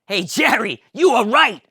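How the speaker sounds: high, loud George with laughs; excited George. Acted. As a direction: realize you're free. George perks up.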